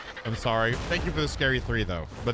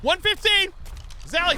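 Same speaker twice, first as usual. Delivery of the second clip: excited; 13 semitones higher than usual. Real, not acted. Calling out in game.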